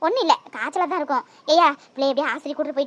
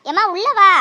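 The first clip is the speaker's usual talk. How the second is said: loud, higher summons; insistent calling out; 4 semitones higher, 12 dB louder than usual. Acted. Grandmother calling a mother with a child in from rain, loud and insistent. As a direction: call out to bring them inside.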